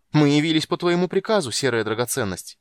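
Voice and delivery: loud, high proclamation; shouted. A narrator's reading. A woman shouts to the grey gem as the door bursts open. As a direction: announce yourselves boldly. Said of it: "прокричал голос".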